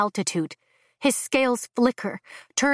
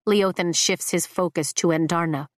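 ordinary delivery

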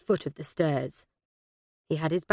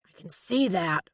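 understated growl from an American agent; a touch of a growl; growled retort. A narrator's reading. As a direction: growl it back, only mildly irritated. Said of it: "growled the American".

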